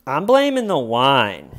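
loud, swooping declaration; mock loud proclamation. Suspect blames the wine to detectives, loudly proclaiming. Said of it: "you're loudly proclaiming that it was the wine".

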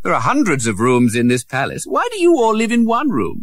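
put-on high, bewildered young voice; a prince asking why they share a room; puzzled prince. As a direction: wonder aloud at the poverty.

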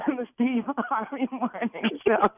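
slow words squeezed through laughter; giggling the name out; laughing answer. Real, not acted.

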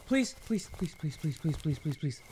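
hushed run of pleas; desperate quiet pleading. Real, not acted. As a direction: plead under the breath. Begging while fleeing enemy.

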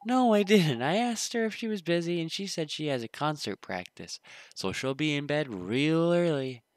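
put-on eager kid reporting back. Kid telling Phil about his mom, lightly voiced.

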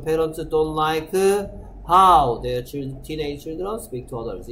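very slow English, fading at the end; slow reading. Shown as a demonstration. Teacher reading the corrected sentence to students, slow. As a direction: read it word by word for the class. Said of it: "slow model reading".

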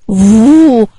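long, loud whoosh of wind; imitated wind sound. Shown as a demonstration. Storyteller imitating autumn wind for children, loud held whoosh. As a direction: blow out a drawn-out "vooo" like wind. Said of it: "وقلد صوت الهواء".

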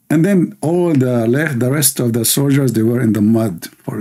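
ordinary delivery

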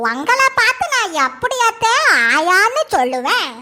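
ordinary talk

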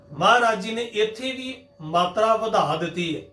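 ordinary talk, nothing marked

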